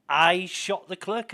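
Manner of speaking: shocked disbelief. Retold or imitated. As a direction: blurt it out, stunned and slow. Suspect repeats it, shocked.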